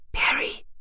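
voiceless whisper of a name. A narrator's reading. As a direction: whisper it to get attention. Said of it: "flüsterte sie". (geflüstert)

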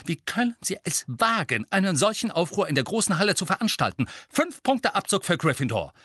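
high, brisk, outraged reprimand; indignant scolding. A narrator's reading. Teacher rebukes a shouting student in the Great Hall, indignant.

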